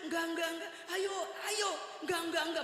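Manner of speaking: high, frantic no, no, no; husband's high frightened refusal; panicked refusal. Retold or imitated. Panicked refusal, wife urging.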